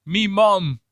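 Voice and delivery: high, mocking British "Me mum"; mock British accent. Streamer teaching chat the British way, in a mock British accent. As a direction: drop a cheeky British "Me mum". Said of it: "just make sure you remember".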